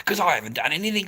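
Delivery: ordinary talk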